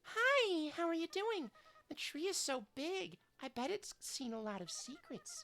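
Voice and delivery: put-on cheerful girl. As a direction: greet them like an eager new friend. Voices new character.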